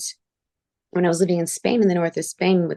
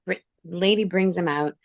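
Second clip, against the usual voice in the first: usual speaking voice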